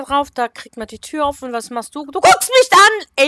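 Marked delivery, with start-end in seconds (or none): 2.1-3.3 louder, higher, slow; spooked cry at the stare; startled outburst. Real, not acted. Figur starrt sie an. (erschrockener Ausruf)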